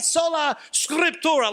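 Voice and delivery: mock Italian sola scriptura; Italian flair. Shown as a demonstration. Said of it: "Say it with some Italian flair".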